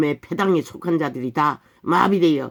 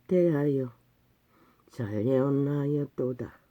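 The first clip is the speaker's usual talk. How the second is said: scripture recitation; 2 semitones lower, 5 dB quieter, slower than usual. Acted. Preacher reading Isaiah's rebuke of hypocrites, slow solemn recitation. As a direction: intone the verse slowly, word by word.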